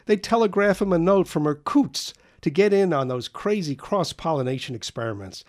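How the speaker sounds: high, loud, scornful tirade; a boy mocking his father to friends; agitated bitter rant. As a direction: spit out contempt for his father. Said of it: "taking the dim and agitated view".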